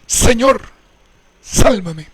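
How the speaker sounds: loud plea in a Bible reading; narrated crying out. A narrator's reading. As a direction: call out for rescue, mildly dramatised. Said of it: "Dio voces diciendo".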